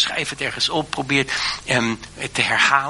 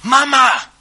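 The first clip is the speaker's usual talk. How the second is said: high, loud cry for mother; a child's sudden call for its mother; children calling for their mother, loud and high; 14 semitones higher, 8 dB louder than usual. Retold or imitated. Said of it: "die riepen".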